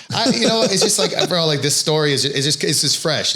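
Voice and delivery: laughing through the words. Real, not acted. Laughing as host asks why he laughs. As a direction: laugh while trying to explain. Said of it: "why you laughing like that, bro?".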